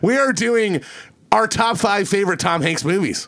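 podcast host introducing the top five Tom Hanks movies; show host intro. Acted. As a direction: kick off the segment like a host. Host announcing podcast segment.

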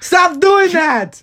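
high, loud, drawn-out protest; exasperated shout. Real, not acted. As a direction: yell at the friend to quit it. Fed up with friend's repeated teasing.

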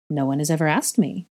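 plain reading voice

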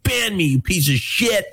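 raging troll yelling insults, drawn out; hammed-up furious viewer, slow and high; angry viewer ranting at a late streamer; mock angry viewer rant. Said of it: "He's like".

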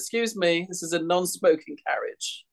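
high, prim little complaint; a prissy passenger tutting politely. Retold or imitated. Prim passenger complains to the smoking couple, high and proper. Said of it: "she says".

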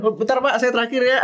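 high-pitched mock plea to an official; pleading rider. Menirukan pembalap minta putaran terakhir. (memohon dengan nada tinggi, menirukan pembalap)

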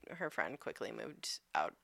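whispered, confiding; whispered aside. Real, not acted. Telling her cohost the friend moved out, whispered. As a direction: drop to a whisper for the gossip.

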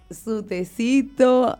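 ordinary delivery

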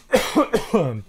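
coughing fit, choking on a drink; real choking cough. Real, not acted. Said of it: "Pardon, je m'étouffe".

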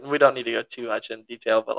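ordinary delivery

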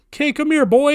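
high, coaxing call to a dog. Retold or imitated. Calling the stray dog over.

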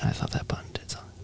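fast whispered aside to himself; muttered whisper. Real, not acted. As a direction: whisper it quickly under your breath. Fumbling with recording controls.

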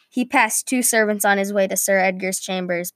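ordinary delivery

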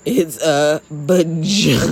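"vagina" drawn out very slowly; retold emphatic correction. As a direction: stretch "vagina" syllable by syllable to correct him. Retold firm correction of dad's euphemism.

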